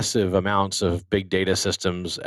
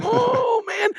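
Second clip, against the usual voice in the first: high-pitched exaggerated groan of "oh"; mock dismay. Host recalling engine failures to guest, mock dismay. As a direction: re-enact dismay with a drawn-out high whoop.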